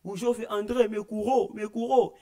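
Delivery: high, loud joyful yell through the streets; shouting joyfully. Retold or imitated. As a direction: call it out to the whole neighbourhood. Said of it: "começou a vir gritar".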